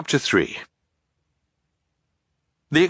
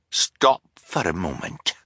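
ordinary delivery, nothing marked

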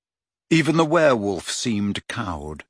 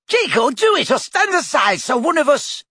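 much higher, louder screech; high screeching demand; 14 semitones higher, 4 dB louder than usual. A narrator's reading. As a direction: shriek at the boy. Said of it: "screeched the woman".

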